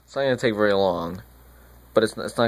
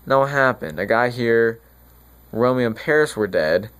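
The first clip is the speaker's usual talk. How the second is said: normal talking voice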